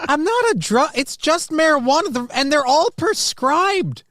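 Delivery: high, pleading and defensive; high whiny defensive voice. Pretend. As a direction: whine back defensively.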